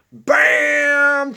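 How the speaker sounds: a sudden high, loud "Bam!"; excited bam. Real, not acted. Teacher cheering Connor's fast score with a loud high shout. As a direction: burst out with joy.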